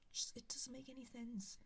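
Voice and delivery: soft exasperated whisper. Real, not acted. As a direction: breathe it out quietly. Whispered aside.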